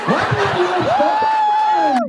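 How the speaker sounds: very high, drawn-out question; slow, high showman's question; theatrical quizzing. Acted. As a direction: push the question way up and stretch it. Repeats the same question theatrically higher.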